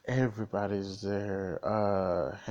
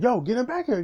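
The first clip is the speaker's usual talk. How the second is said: much higher, louder panicked demand; freaking out; 14 semitones higher, 7 dB louder, faster than usual. Retold or imitated. Sam panics when Cap doesn't return, high alarmed yell. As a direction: panic and push to get him back. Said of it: "Sam freaking out".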